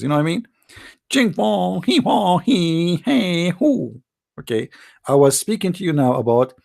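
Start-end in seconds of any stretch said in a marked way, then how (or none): 1.1-4.0 higher, hammed-up fake Chinese babble; mock Chinese gibberish. Comedian showing off fake Chinese to the crowd. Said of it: "I can't speak Chinese now".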